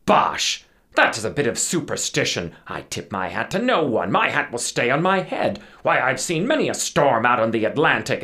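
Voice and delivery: loud, blustering dismissal; defiant cry. A narrator's reading. Captain staring down superstitious crew, loud defiance. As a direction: scoff loudly, brooking no argument. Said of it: "stared them down and cried".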